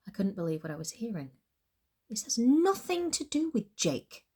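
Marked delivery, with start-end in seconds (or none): 2.1-4.3 shot back. A narrator's reading. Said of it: "I shot".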